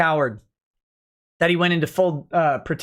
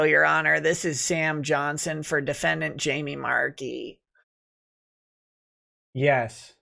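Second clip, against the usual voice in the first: sleepy phone voice; 2 semitones lower, 5 dB quieter than usual. Acted. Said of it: "Sam Johnson, who I think maybe fell asleep or died on the phone call".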